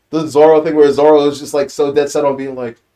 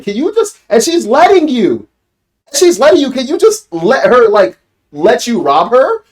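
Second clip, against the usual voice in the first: high, loud, disbelieving call-out at the screen; incredulous rant; 11 semitones higher, 6 dB louder than usual. Retold or imitated. Viewer ranting at Nami over robbing Kaya, loud and incredulous. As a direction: yell at her like she's crazy for stealing. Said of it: "I'm like".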